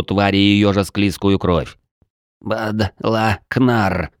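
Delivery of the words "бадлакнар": slow wet gurgling creature voice; pitiful gurgle. A narrator's reading. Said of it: "жалобно пробулькало существо".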